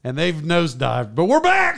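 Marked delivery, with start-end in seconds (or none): 1.2-1.8 much higher, louder burst; excited announcement. Real, not acted. Host telling fans the show returned, excited shout. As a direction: announce the comeback with a big lift.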